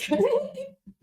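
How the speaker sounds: a burst of high-pitched giggling; high giggle. Real, not acted. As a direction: giggle at your own joke. Laughing at own bit.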